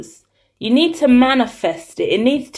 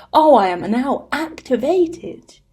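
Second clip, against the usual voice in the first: favourite narrator voice; 2 semitones higher than usual. Pretend. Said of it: "this is my favorite voice".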